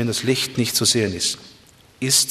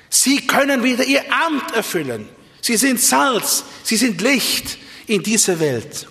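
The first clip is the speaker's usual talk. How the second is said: impassioned preaching; 10 semitones higher than usual. Real, not acted. Preacher tells listeners their office is restored, impassioned preaching. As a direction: proclaim it from the pulpit, stretching key words.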